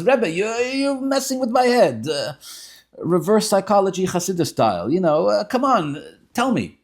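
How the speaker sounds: high, loud exasperated pleading; worked up. Retold or imitated. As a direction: act out a man being wound up. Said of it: "the guy is getting worked up".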